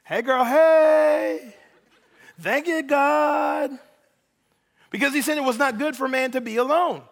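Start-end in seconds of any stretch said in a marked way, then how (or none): 0.0-3.8 Adam delighted by Eve. Retold or imitated. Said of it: "And Adam was like".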